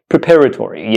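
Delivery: "preparatory" said pruh-PAR-uh-tory; "preparatory" in one smooth run, opening on "pruh"; correct pronunciation. Shown as a demonstration. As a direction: model "preparatory" with a short first syllable and stress on PAR. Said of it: "the correct pronunciation of this word".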